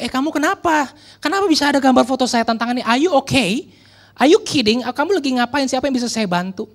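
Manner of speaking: high, rapid, urgent questions; acted-out alarmed phone call; alarmed questioning. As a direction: fire off worried questions in disbelief. Pastor urgently phones the teen.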